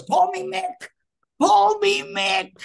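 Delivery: very high, loud imitated scream; screaming Mickey Rooney. Mickey introduces himself to the newcomer, screaming at him. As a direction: yell it in his face, stretching the repeat. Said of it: "He's just screaming at me".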